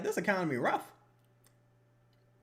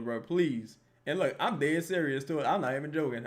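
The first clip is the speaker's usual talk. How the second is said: ordinary delivery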